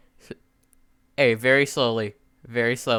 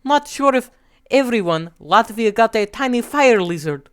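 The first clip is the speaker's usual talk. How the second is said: put-on high-pitched Russian-accented voice; 8 semitones higher, 4 dB louder than usual. Russia telling which Baltic country got which creature, Russian accent. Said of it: "Yo, okay, the accent is perfect".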